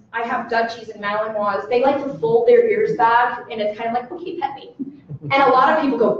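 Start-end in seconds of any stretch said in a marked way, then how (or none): 4.1-4.7 unvoiced, soft invitation; soft dog voice. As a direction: whisper it as the calm dog. Voicing the relaxed dog.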